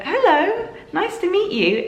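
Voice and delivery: very high, sing-song hello to a newborn; baby-talk greeting. Retold or imitated. Mother greeting her newborn for the first time, high baby-talk greeting.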